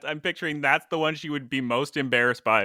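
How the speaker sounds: usual speaking voice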